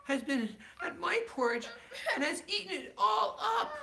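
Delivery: high, small, crying little bear; little wee bear voice. Acted. Parent reading Goldilocks to child, tiny wee bear voice. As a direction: squeak it out like a tiny upset cub. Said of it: "in his little, small, wee voice".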